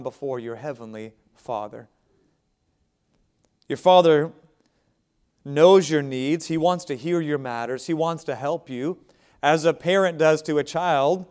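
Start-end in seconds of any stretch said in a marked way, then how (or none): none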